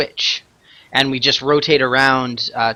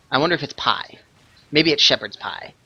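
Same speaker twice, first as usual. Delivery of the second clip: ordinary delivery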